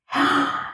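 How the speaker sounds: sharp unvoiced intake of breath; startled gasp. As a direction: gasp like a stung fox. Fox jumps up when stung.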